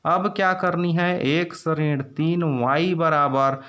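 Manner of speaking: normal talking voice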